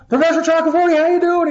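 loud, high-pitched mock hello; hammed-up excited phone hello; exuberant greeting. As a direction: greet an old professor at top volume.

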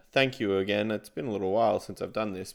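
normal talking voice

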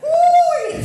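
high, loud cackling laugh ending in a whoop. Real, not acted. Comedian cracking up at his own punchline, loud whooping laugh. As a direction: let the laugh explode into a whoop.

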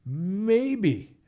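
Maybe lifted high and drawn out on its own; emphasized maybe. Real, not acted. Boxing host to viewers, stressing a doubtful Maybe. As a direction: lean hard on Maybe, let it hang doubtfully. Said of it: "and I emphasize the word maybe".

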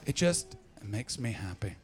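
ordinary talk, nothing marked

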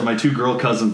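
normal talking voice

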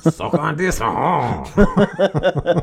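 high, hammed-up French taunt; mock French accent ending in a showy chuckle. Host playing French president taunting Biden, silly high French accent. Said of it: "my French accent goes everywhere".

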